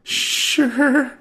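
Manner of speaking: high chirpy desk clerk; Tracy voice. Acted. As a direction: pitch way up for the clerk.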